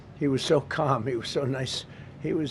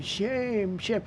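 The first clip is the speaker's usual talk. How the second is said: mock opera-singer scream; 7 semitones higher than usual. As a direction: imitate a protester shrieking like an opera singer. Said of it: "She's screaming".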